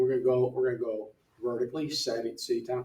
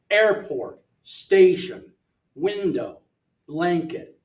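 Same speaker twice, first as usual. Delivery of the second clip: AIRport, STAtion, WINdow, BLANket, first syllable louder and longer; first-syllable stress; 4 semitones higher, 6 dB louder, slower than usual. Shown as a demonstration. Teacher shows learners noun stress, first syllables of Airport, station, window, blanket stressed. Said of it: "the first syllable is louder and longer".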